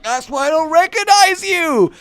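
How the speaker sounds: high, loud exclamation; mock-delighted penny drop, pitched right up; loud realization. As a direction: call it out high and over-bright. Retold loud realization to fitter.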